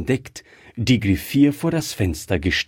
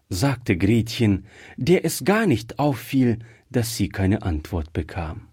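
plain reading voice

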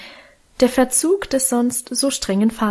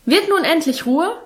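scolding father; 7 semitones higher than usual. A narrator's reading. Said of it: "schalt Vater in erhöhter Lautstärke".

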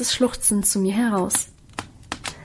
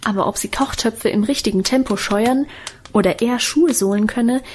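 usual speaking voice

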